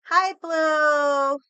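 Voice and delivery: light up as the pet walks in; greeting pet. Real, not acted. Greeting the pet Blue, a loud high excited hello.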